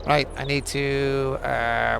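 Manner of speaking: usual speaking voice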